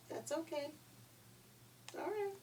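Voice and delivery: high soft little pig; high piggy voice. Acted.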